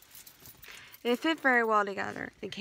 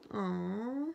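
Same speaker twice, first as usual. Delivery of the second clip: usual speaking voice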